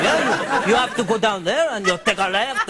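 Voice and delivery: lightly put-on French accent; a half-hearted French accent while giving directions; mild mock French accent to a French couple; ludicrous French accent. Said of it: "the most ludicrous French accent".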